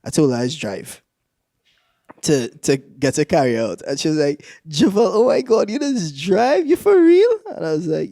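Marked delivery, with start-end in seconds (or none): 4.7-7.3 high-pitched gushing, rising at the end; mock impressed girl. Girl amazed he drives, mock excited. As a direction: imitate a girl thrilled by the news.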